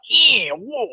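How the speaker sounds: very high, swooping Cockney orc cry; hammed-up Cockney orc voice. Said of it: "if he had an English Cockney accent".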